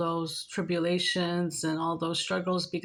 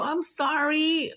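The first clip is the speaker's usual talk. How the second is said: high, drawn-out retold apology; acted-out cooing commiseration; sympathetic; 8 semitones higher, much slower than usual. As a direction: stretch the sympathy out, pitched high. Acts out her sympathetic reply.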